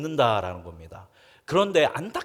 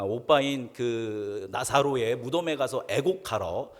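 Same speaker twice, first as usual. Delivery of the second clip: ordinary delivery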